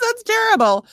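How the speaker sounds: high, loud mock horror; mock outrage. Real, not acted. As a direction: cry out in fake shock.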